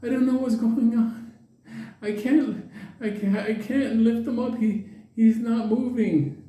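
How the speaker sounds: put-on high old-lady voice; imitating a fretful old woman; bewildered old lady. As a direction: fret in a high, halting voice. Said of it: "And she's like".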